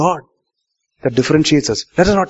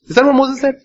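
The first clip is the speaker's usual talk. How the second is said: pitched up high in disbelief; incredulous challenge; 10 semitones higher, faster than usual. Real, not acted. Preacher challenging the congregation, high and incredulous. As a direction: ask it like it's absurd.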